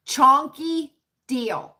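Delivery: Chonky drawn out slowly, savouring each syllable; slow savoured term. Shown as a demonstration. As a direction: relish the words Chonky deal slowly. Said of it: "I heard a fabulous term".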